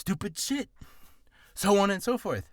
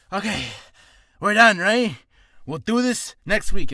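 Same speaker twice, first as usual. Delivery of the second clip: exhausted plea; 7 semitones higher, 12 dB louder than usual. Retold or imitated. Retold worn-out actor ending session.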